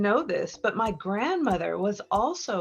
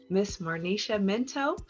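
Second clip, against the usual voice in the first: normal talking voice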